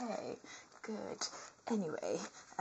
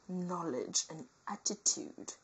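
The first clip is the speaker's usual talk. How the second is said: ordinary talk, nothing marked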